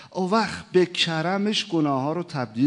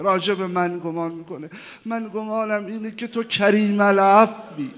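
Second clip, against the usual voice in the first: louder, higher pleading to God; impassioned prayer; 5 semitones higher, 10 dB louder than usual. Acted. Preacher reminding God of His promise, impassioned.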